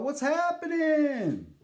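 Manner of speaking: high, slow drawled greeting; Isaac's voice. Retold or imitated. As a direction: stretch it out high and easy like the bartender. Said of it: "he's all".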